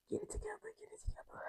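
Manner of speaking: very soft voiceless whisper; barely audible, steadying yourself after tears; whispered self-talk. Real, not acted. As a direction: whisper to yourself to pull it together. Whispers to self to regain composure.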